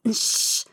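sharp voiceless "sch"; urgent hushing hiss; hissed shush. A narrator's reading. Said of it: "zischte ich".

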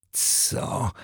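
sharp unvoiced gasp of surprise; startled whispered what. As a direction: hiss it out in shock. Startled girl gasps.

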